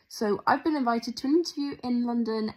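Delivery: ordinary talk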